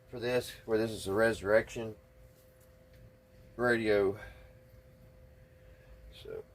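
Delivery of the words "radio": usual speaking voice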